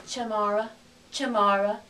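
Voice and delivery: English-accented "Chamara", first vowel reduced to a schwa; English-speaker accent. Shown as a demonstration. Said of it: "An English speaker would see this word".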